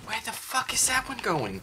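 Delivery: high, loud frightened shout; alarmed yell. Real, not acted. Gamer losing track of a monster, yelling. Monster moves fast in game.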